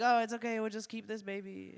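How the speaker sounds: high-pitched appeasing parent; acted polite retreat; meek backing down. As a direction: give in, thin and high. Parents back down.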